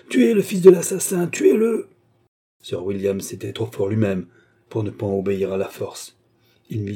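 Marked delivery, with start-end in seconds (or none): 0.1-1.9 loud, high, crazed shouting; frenzied high yell; howling. A narrator's reading. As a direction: scream for blood like a madman. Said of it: "hurla l'idiot".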